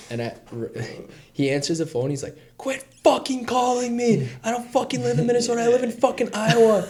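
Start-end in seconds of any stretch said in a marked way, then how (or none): 2.6-6.9 high, furious yelling caller; mock furious ranting; angry shout. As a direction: bark it like an enraged stranger on the phone. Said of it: "he basically was screaming at me".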